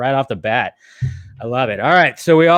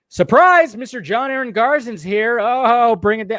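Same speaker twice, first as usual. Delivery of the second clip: high, excited guest announcement; surprise guest welcome; 7 semitones higher than usual. Real, not acted. Host greeting John Aaron Garson's arrival, excited announcement. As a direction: announce the arrival in a raised, celebratory voice.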